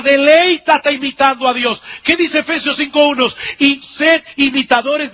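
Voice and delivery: rising to a fervent climax; preaching at full force. Acted. Predicador sube a plena fuerza. (predicación con toda la fuerza)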